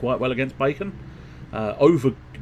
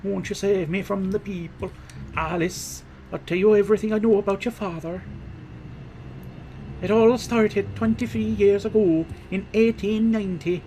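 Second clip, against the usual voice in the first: a stagey Irish priest's voice that drifts toward Welsh, higher pitched; Irish accent turning Welsh; 5 semitones higher than usual. Acted. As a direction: tell the old tale in a wandering Irish-Welsh accent. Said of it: "I've gone slightly more Welsh".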